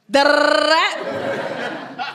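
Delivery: high, slow, laughing da-da-da babble; rapid chatter noise. Retold or imitated. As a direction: babble on like you can't stop texting. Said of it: "a visual of what these conversations look like".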